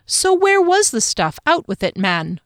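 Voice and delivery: high, loud opening, eager; excited demand. A narrator's reading. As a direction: press him eagerly for the answer. Joyful friend demands the answer.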